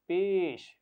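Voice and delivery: pesh said clearly and loud, for the class to repeat; teacher's model word. Shown as a demonstration. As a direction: call out پیش slowly for the children. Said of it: "سب مل کر بولیے".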